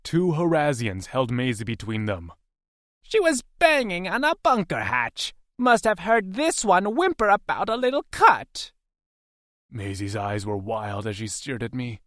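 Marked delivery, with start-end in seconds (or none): 3.0-8.7 much higher, louder sneering voice; mocking captor. Captor jeering at the prisoner's whimpering, high sneer. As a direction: jeer at his weakness.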